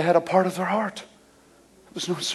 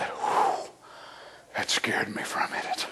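death relieved; 11 semitones lower, 11 dB quieter than usual. Acted. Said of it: "Death said".

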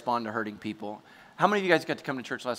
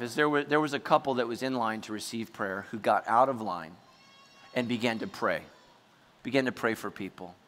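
ordinary talk

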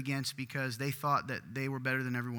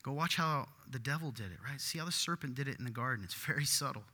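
usual speaking voice

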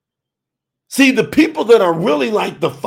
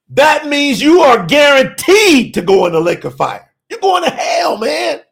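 usual speaking voice

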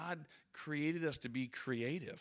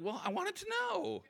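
laughing. Retold or imitated. Laughs defending his question.

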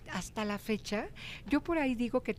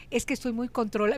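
ordinary delivery

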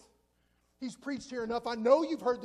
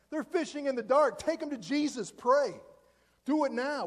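usual speaking voice

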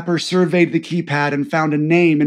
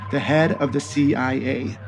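ordinary delivery, nothing marked

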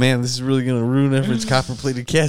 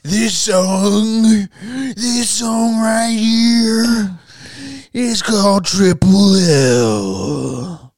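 higher, very slow drawn-out hype; radio hype announcer; 7 semitones higher, 3 dB louder, much slower than usual. Pretend. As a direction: stretch out each word like a DJ. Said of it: "do your best rap promo".

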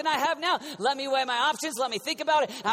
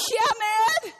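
pleading Jesus; 10 semitones higher, 5 dB louder than usual. Pretend. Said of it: "out of pleading with him".

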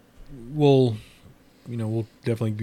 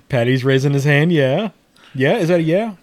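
louder, higher call across the room; 4 semitones higher, 7 dB louder than usual. Real, not acted. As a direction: raise your voice to reach the room. Calling out to guests in room.

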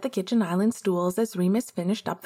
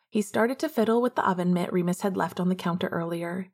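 plain reading voice

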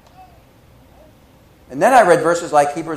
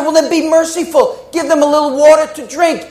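pleading; 8 semitones higher, 3 dB louder than usual. Retold or imitated. Sister pleading with gatekeeper.

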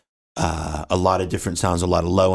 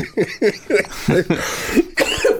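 high burst of laughter; amused laugh; 13 semitones higher than usual. Real, not acted. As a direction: laugh at the complexity. Laughs at complicated setup.

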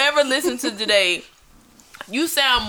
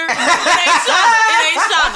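emphatic correction; 5 semitones higher, 6 dB louder than usual. Real, not acted. Storyteller correcting a friend who jumped in, loud and emphatic. Mock-scolding an interrupter amid laughter.

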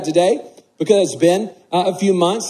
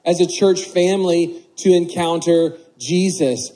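usual speaking voice

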